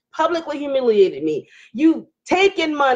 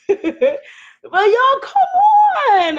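very high, drawn-out plea with a laugh; playful pleading; 14 semitones higher, 6 dB louder, much slower than usual. Real, not acted. Streamer begging her viewers to be reasonable, playful pleading. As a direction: whoop it up high, stretching the words.

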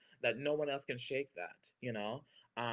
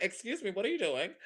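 high, loud mock telling-off; playfully affronted, pitched up; startled indignation; 8 semitones higher, 6 dB louder than usual. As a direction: challenge your own hand in comic disbelief. Acts scolding own right hand.

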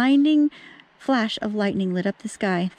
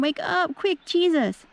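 higher, urgent calling to wake him; panicked plea; 8 semitones higher, slower than usual. Acted. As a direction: plead in a rush of fear. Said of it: "they screamed".

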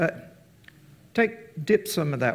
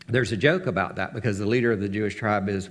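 usual speaking voice

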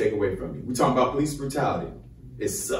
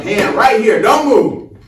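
higher, louder barked police order; cop's command; 8 semitones higher, 11 dB louder, slower than usual. Retold or imitated. Cop ordering him during a traffic stop, loud command. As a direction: bark it at him like the officer did. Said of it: "My man come".

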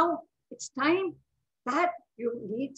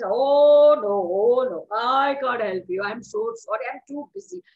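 refusing pig; 2 semitones lower, 6 dB louder than usual. Acted. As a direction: moan it like a put-upon pig. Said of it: "And the pig says".

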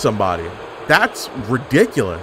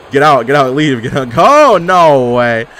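louder, frantic get-out; urgent yelling; 7 dB louder, slower than usual. Real, not acted. Gamer frantically yelling at a wrestler, loud urgent. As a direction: shout them out of the ring. Urging opponent away, shouting.

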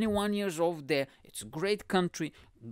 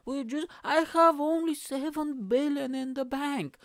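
mock sob story; 7 semitones higher than usual. Poor billionaire begs pity over fancy parties, mock-tearful pleading. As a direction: plead pitifully, hammed up. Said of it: "they have, like, a really sad music".

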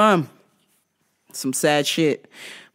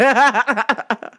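quick burst of laughter; real amused cackle; short laugh; 5 semitones higher, 3 dB louder than usual. Real, not acted. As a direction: laugh at your own line. Cracking up at own joke.

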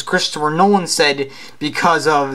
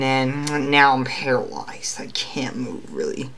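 weak breathless whisper; 3 semitones lower, 6 dB quieter than usual. Acted. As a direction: whisper it, too paralyzed to speak. Paralyzed Spider-Man, barely speaking.

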